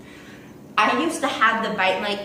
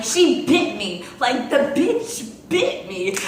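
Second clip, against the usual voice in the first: laughing while telling; 5 semitones higher, 5 dB louder, slower than usual. Real, not acted. Telling teachers about the bite.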